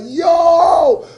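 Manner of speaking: loud, high, stretched hype shout; retold crowd roar; excited crowd reaction. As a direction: holler it like a hyped French crowd. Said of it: "They was like".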